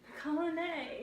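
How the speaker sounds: colony with a British-style "ay" ending; British accent. Shown as a demonstration. Prompter echoing the reader's slip, in a light British accent. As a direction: echo the British-sounding colony, softly.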